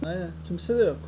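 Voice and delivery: ordinary delivery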